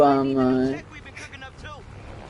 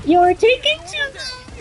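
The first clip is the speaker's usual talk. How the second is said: much higher, tiny squeaky voice. Pretend. Gamer mocks the long intro in a high squeaky voice. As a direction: squeak it like a cartoon chipmunk.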